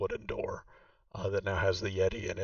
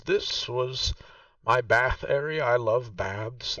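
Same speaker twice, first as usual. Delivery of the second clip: put-on a raised, slower voice for the old woman Vaku; game master voicing Vaku, noticeably higher; Vaku's voice; 4 semitones higher, 6 dB louder, slower than usual. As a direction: play the proud host showing her old home. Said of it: "she says".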